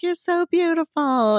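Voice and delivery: high, slow imitation of an admiring girl; gushing. Counter girl to a masked customer, imitated high gushing. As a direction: coo the compliment up high, drawn out.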